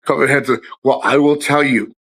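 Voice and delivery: ordinary talk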